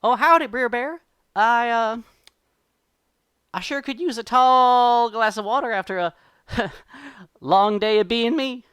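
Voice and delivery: high, loud rabbit voice; loud, high-pitched cartoon trickster; calling out. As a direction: holler a chummy howdy from afar. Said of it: "and he calls out".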